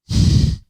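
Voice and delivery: heavy exhale after a rant; loud, voiceless sigh. Real, not acted. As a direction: blow out a big breath. Big breath out after the rant.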